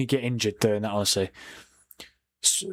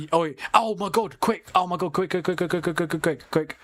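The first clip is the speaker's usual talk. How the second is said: higher-pitched, frantic repeated urging; flustered panic; 5 semitones higher than usual. Real, not acted. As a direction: hurry yourself along in a fluster. Rushing to set up forgotten poll.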